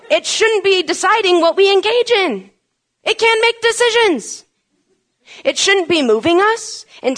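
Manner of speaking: loud, high and driving, hammering each line home; preaching at full force. Acted. Preacher hammering death to the flesh into the congregation, full force. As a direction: preach it out, pounding every phrase.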